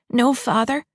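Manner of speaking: put-on high, pleading little girl. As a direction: beg like a young daughter. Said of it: "Amy pleaded".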